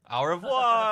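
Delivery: high, loud French farewell; put-on French accent. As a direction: sing out a French goodbye. Farewell in game character's accent.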